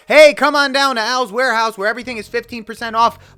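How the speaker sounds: loud, high, corny warehouse pitchman; goofball ad announcer. Acted. Voice actor mocking his goofball castings, loud corny announcer. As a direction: belt out an over-enthusiastic sale ad. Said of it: "casted so many times as the fucking goofball".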